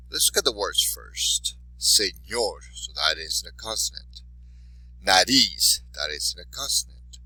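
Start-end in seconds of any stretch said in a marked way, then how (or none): 1.8-2.6 Señor with the second syllable stressed; stress on last syllable. Shown as a demonstration. Teacher modelling words for students, last syllable of Señor stressed. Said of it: "making sure you stress the syllable". 5.0-5.8 Nariz with the final "riz" stressed; stress on last syllable. Shown as a demonstration. Teacher modelling words for students, last syllable of Nariz stressed. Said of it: "accent on the last syllable".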